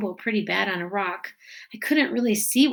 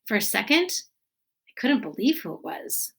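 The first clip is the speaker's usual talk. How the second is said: ordinary delivery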